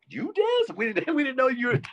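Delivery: very high surprised question; mock surprised guard. Guards amazed the tattooed inmate can dance, very high.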